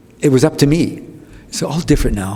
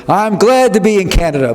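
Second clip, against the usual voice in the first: comic's stage address; 9 semitones higher than usual. Retold or imitated. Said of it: "He gets there and he says".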